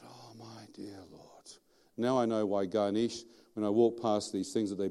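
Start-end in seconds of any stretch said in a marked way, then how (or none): 0.0-1.5 slow, barely audible whispered dismay; aghast whisper. Retold or imitated. Man learning demons came from an idol, whispering in dismay. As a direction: whisper it slowly, as a dawning realisation. Retells his horrified realisation.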